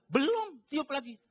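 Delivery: high-pitched, emphatic mock order; insistent command. Trainer making trainee keep blowing the balloon, insistent command. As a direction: act out a relentless trainer.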